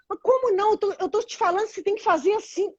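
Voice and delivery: high, insistent mock husband; protesting husband. Acting the husband answering his wife, insistent protest. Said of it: "Aí ele fala assim".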